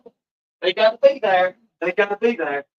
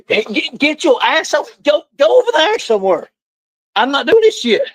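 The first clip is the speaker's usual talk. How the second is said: high, flustered shouting at the dogs; real fed-up scolding; exasperated yelling; 5 semitones higher than usual. Real, not acted. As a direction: drive them off with an angry holler. Chasing the dogs apart.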